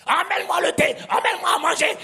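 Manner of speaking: high, loud barked orders; shouting orders. Retold or imitated. A preacher imitates someone shouting at home, yelling. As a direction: bark it like a bossy yeller. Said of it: "tu es en train de crier".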